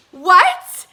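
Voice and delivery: loud, high shocked question; shocked outburst. Retold or imitated. Fan learning she can be barricade, shocked outburst. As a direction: blurt it out loudly in disbelief. Retold disbelief, escalating.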